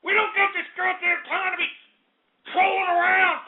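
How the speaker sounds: high, exasperated rant. Acted. Host ranting at callers.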